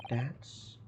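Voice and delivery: ordinary talk, nothing marked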